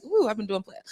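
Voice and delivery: high, lightly voiced wonder; pleased surprise. Actress realises how much work she has done, pleasantly surprised. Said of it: "I was like".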